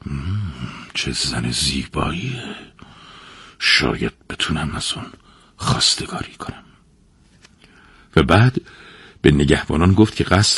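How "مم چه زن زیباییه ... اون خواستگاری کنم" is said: muttering under breath. A narrator's reading. King musing to himself over the woman's portrait, muttering. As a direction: murmur it to yourself, low and hushed. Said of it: "زیر لب گفت".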